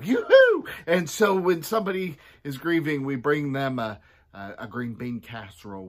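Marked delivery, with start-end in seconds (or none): 0.0-0.7 very high, loud sing-song yoo-hoo; high loud yoo-hoo call. Acted. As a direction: call out cheerfully at the door. Calling out bringing food.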